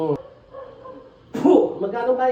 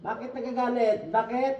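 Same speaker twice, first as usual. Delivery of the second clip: ordinary talk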